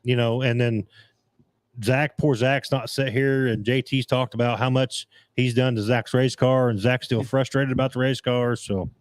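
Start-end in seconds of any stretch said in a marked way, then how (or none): none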